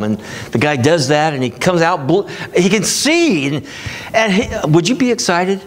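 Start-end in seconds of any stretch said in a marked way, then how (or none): none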